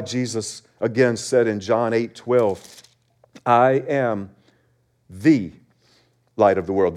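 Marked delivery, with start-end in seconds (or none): 3.4-6.9 "the" said as "thee", set apart by pauses; stress on THE. Shown as a demonstration. Preacher quoting Jesus as the only light, stressing "the". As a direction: pause before and after a weighty "the". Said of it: "There's an emphasis there".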